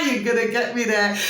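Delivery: words squeezed out through a laugh. Real, not acted. Laughing to her viewers about being caught out. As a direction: still giggling as she speaks.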